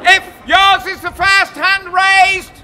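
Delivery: booming public proclamation; town crier. Acted. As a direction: proclaim it to the whole square. Crier announcing contest.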